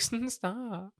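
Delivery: high, soft stammered stop; high, soft mock protest; mock girl voice. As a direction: squeak out a startled stop. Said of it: "She's like".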